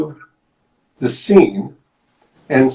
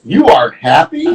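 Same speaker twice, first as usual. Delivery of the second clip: louder, rising puzzled question; confused question; 2 semitones higher, 5 dB louder than usual. Acted. Storyteller voices Piggy a little confused, questioning. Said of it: "is a little confused".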